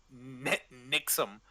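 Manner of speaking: ordinary delivery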